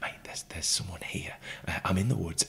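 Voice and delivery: urgent whisper on the phone. Retold or imitated. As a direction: whisper so nobody hears. Phoning mate, hiding from stranger.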